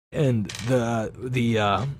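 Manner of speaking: usual speaking voice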